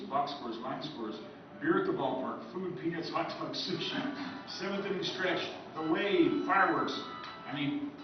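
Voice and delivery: ordinary talk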